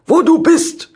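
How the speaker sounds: loud, high, broken German shout; bellowing in broken German. Acted. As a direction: bark it like a furious farmer. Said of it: "hörte ich Bauer Helmut brüllen".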